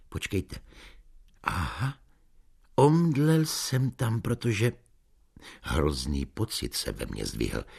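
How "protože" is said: usual speaking voice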